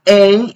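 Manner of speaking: A said "ay", as in the English alphabet; English letter A. Shown as a demonstration. Teacher showing the letter A, said as English "ay". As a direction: say A as the diphthong "ay". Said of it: "esta letra A la pronunciamos como A".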